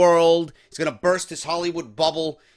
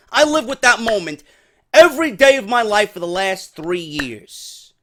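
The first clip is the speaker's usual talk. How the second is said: mock angry rant, higher and louder; agitated Seth; 5 semitones higher, 4 dB louder than usual. Seth Rollins pressing Dean about the past, mock agitated rant. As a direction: play Seth fired up, pleading hard. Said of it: "gets fucking agitated. He gets pissed".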